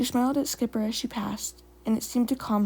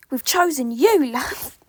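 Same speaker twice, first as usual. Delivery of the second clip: put-on British accent; 3 semitones higher, 7 dB louder, slower than usual. A narrator's reading. Spotted Leaf announcing who is chosen, British accent. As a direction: proclaim it in a hammed-up British accent. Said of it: "A British accent".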